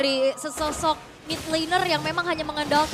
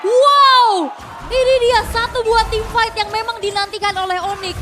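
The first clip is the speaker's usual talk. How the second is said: loud thrilled wow; excited shout; 4 semitones higher, 7 dB louder than usual. Real, not acted. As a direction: burst out with hype. Caster reacts to teamfight.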